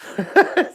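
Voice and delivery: high squealing burst of laughter. Real, not acted. Cracking up at viewers wanting his fridge, squealing laugh.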